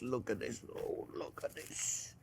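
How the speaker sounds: breathless whisper, slowed in awe; whispered admiration. Real, not acted. Cook admiring the wrapped pork, awed whisper. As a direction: whisper it like a treasure you found.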